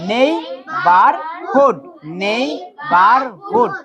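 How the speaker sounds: slow "নেইবারহুড" in three beats; syllable by syllable. Shown as a demonstration. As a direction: sound out নেই-বার-হুড. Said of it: "একবারে যতটুকু অংশ উচ্চারণ করতে পারবো".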